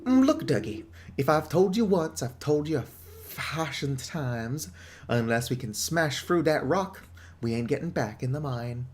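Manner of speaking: gruff British pub owner, lightly voiced; British publican. Pub owner warning the outsider off, British accent. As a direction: warn off the outsider like an old barkeep.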